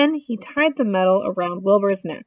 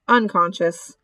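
ordinary delivery

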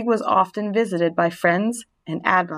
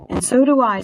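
Nellie pledging; 5 semitones higher, 4 dB louder than usual. A narrator's reading. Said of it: "said Nellie".

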